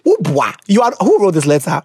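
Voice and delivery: normal talking voice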